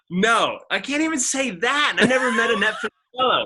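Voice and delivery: high, loud, animated admission; excited self-mockery. Real, not acted. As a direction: pile it on, laughing at yourself. Excited self-mocking confession.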